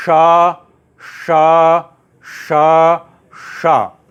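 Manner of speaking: each शा loud and drawn out, tongue curled back; retroflex sha drill. Shown as a demonstration. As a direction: project long retroflex शा, one at a time. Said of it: "इस वाले शा का उच्चारण". (शा का ज़ोरदार मूर्धन्य अभ्यास)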